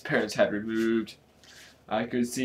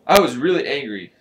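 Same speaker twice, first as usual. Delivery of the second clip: plain reading voice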